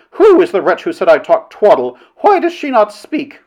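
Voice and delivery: affronted demand climbing high; angry demand. A narrator's reading. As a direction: challenge the hidden heckler. Demanding the heckler show herself.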